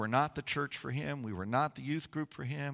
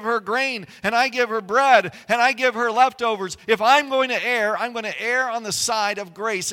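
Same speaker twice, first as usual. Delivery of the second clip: higher, louder preaching as Boaz; Boaz declaring loudly; 8 semitones higher, 9 dB louder than usual. Acted. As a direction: proclaim it like a bold vow. Preacher voicing Boaz at full force.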